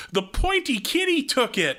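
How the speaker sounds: quoting Ralphie in a high kiddie voice. Retold or imitated. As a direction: imitate a dim little kid. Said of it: "Ralph points and goes".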